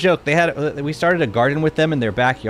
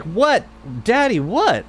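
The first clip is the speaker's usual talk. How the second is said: imitating daughter; 7 semitones higher, slower than usual. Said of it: "Sophia was like".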